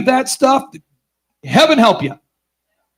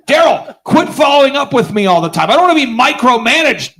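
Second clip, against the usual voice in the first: higher-pitched, mock-irritated protest; whining professor; 4 semitones higher than usual. Professor telling Daryl to stop following up, mock annoyance. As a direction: act out a fed-up professor complaining.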